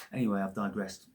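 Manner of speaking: ordinary delivery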